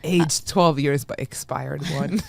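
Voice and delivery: ad voiceover. Pretend. Mock whiskey ad.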